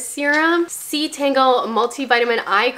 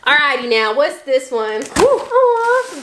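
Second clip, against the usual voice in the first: ordinary talk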